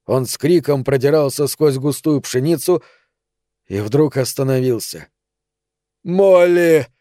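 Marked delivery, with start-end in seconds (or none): 6.0-6.9 high, loud narrated cry of a name; cried out. A narrator's reading. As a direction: call the name out in anguish. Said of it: "выкрикнул он". (громкий, высокий выкрик)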